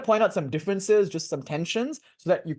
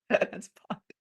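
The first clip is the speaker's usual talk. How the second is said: higher, giggling through the bit; laughing; 7 semitones higher than usual. Real, not acted. Repeating the bit while laughing.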